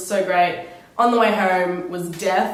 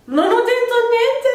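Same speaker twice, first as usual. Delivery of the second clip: much higher, louder protest in Italian; panicked denial; 6 dB louder than usual. Retold or imitated. Vlogger retells protesting her innocence to the stranger, high and loud. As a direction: squeak out your innocence. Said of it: "And I was like".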